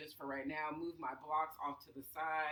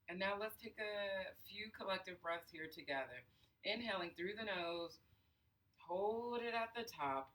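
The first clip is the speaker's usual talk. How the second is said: guided breathing. Acted. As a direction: count the breaths, unhurried and soothing. Leads a breathing exercise.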